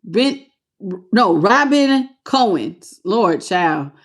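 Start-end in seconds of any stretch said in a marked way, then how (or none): none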